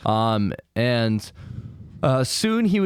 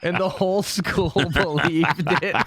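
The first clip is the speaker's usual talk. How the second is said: laughing; 7 semitones higher than usual. Real, not acted. Funny story punchline.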